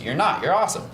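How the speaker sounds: ordinary talk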